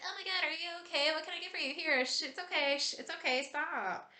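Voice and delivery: fussy comforter. Retold or imitated. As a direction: play the flustered friend rushing to hush the tears. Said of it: "We're like".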